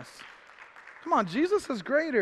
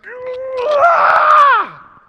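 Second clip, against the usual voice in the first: high, loud exasperated yell; a mother at the end of her rope; sharp fed-up shout; mom's frustrated cry; 11 dB louder than usual. Retold or imitated. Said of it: "She said, ... I'm like".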